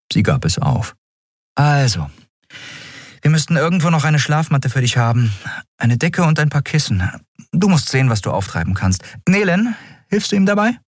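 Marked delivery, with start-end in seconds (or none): 1.5-10.8 higher-voiced captain giving orders; captain's higher, businesslike voice. A narrator's reading. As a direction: play a practical captain sorting things out. Captain arranges bedding for newcomer.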